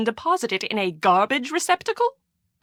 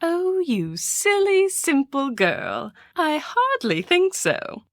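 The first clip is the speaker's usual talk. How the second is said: condescending; 7 semitones higher, slower than usual. Acted. Condescending to the girl.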